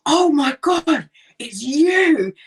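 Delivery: high, loud and slow, stretching out the awe; starstruck gasp. Retold or imitated. Maze worker recognising Leslie the next morning, starstruck high squeal. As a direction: gush like meeting a celebrity. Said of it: "she saw Leslie and went".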